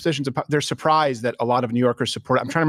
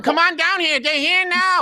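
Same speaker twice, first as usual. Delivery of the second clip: much higher Serpico-era Pacino imitation; young Al Pacino; 14 semitones higher, slower than usual. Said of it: "before Al Pacino faked his voice".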